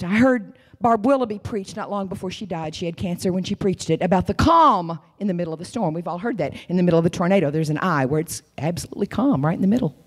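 usual speaking voice

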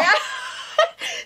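unvoiced, high, wheezing laugh; a mother wheezing with laughter at her own prank; breathless high laugh. Real, not acted.

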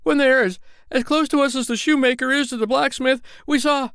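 high, loud and breathless storytelling; thrilled to tell the tale; eager telling. Acted. Tailor tells the gathered crowd about the bear, excited. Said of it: "said the tailor".

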